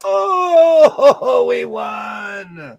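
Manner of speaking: loud high cheering; excited cheer. Real, not acted. Team won, cheering.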